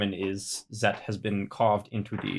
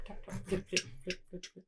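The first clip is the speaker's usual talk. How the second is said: soft whispered tapping noises; whispered sound effect; 8 semitones higher, 15 dB quieter than usual. Acted. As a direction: breathe out little tapping sounds. Tapping the step cautiously.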